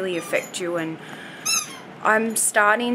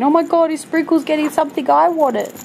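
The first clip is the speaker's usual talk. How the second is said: fast, high, loud and frantic little dog; Charlie's inner voice; 8 semitones higher, 6 dB louder, faster than usual. Retold or imitated. Owner voicing the jealous dog Charlie, fast and frantic. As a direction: blurt it out like a greedy pup.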